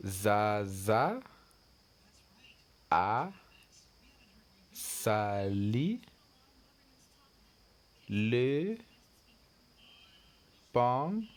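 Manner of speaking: Zaza a sali le pantalon, each word split by a pause; word-by-word reading. Shown as a demonstration. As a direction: read it slowly for the learners. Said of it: "look at the next sentence".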